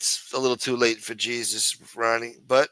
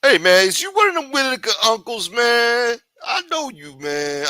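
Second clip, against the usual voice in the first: high, loud street-tough voice; jailhouse inmate; 12 semitones higher, 8 dB louder than usual. Acted. Inmate spotting a famous new cellmate, high loud tough voice. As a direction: call out like a cellmate sizing up the new guy.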